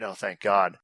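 ordinary talk

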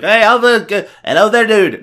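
a failed, high, loud attempt at Irish; bad Irish accent. Host mocks co-host's Irish trip in a botched Irish accent. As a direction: try an Irish voice and miss. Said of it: "that's not my Irish".

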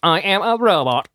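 lightly voiced monotone robot; flat robot announcing itself; emotionless robot. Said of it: "We could pretend we're robots".

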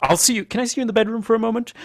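high, rapid put-on voice; Abe. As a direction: rush out a tense, high-pitched request. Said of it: "And Abe, who's had these great moments".

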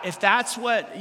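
usual speaking voice

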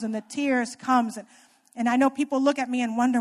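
ordinary talk

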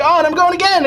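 high, bright mock bragging; mocking a friend's Disney post, high and chirpy; chirpy Facebook poster. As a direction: imitate a gushy Disney poster.

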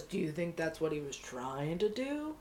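a hint of a deep-voiced boss, quietly prompting; Richards. Lieutenant prompting the officer, soft imitated deep voice. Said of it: "he talked in this really low, deep voice, slow".

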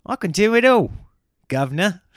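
loud, high mock British accent; proudly hammed-up British gent. Comedian boasting he can do accents, British. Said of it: "to Australian to British".

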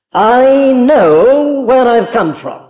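drawn-out ringing declaration; emphatic proclamation. Acted. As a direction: declare it like a solemn claim. Preacher voices Christ's claim.

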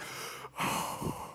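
a long breathy sigh of dread; long unvoiced sigh. Pastor sighing while hearing the staffer's news. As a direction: let out a heavy sigh.

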